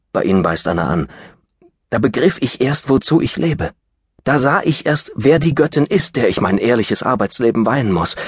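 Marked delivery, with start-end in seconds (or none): 1.9-8.2 higher, rising with ardour; passionate declaration. Acted. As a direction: pour out his love, swelling. Lapkin's love declaration.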